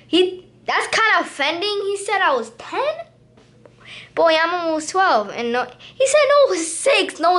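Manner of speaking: high, indignant outrage; offended disbelief. Real, not acted. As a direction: squeak out the outrage. Offended about his age.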